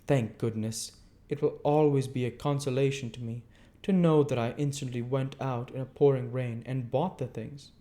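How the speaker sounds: prim lady. Acted. Camilla's long complaint.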